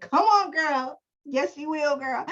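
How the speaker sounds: hype cheer. Real, not acted. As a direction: hype up a friend. Cheering on an affirmation.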